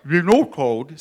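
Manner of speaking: loud, muffled words round a mouthful; garbled by a pretend mouthful; mouth full. A narrator's reading. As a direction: talk as if stuffed with cookie. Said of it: "with his mouth full".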